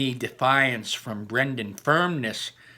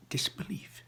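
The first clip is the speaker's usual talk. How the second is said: whisper; 5 semitones higher, 11 dB quieter than usual. A narrator's reading. Classroom stunned silence.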